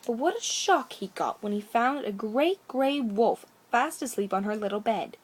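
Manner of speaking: ordinary delivery, nothing marked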